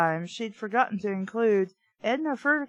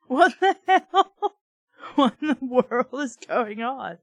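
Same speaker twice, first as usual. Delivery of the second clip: laughing disbelief; 3 semitones higher, 4 dB louder than usual. Real, not acted. Reader cracking up at the talking meatball. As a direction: crack up mid-question. Reacting to the absurd dream.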